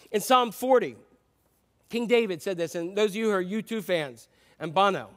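usual speaking voice

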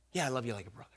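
low, subdued admission; quiet, understated brotherly affection; brotherly reply. Acted. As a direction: say it softly and low, almost to yourself. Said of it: "the way he responds is like a brother".

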